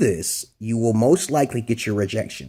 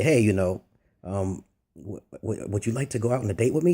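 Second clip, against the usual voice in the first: shy; 4 semitones lower, 5 dB quieter than usual. Shown as a demonstration. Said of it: "You act a little shy".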